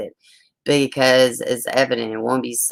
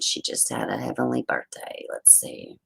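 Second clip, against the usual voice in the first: whisper; 14 semitones lower, 10 dB quieter than usual. Real, not acted. Whisper about the murdered baby.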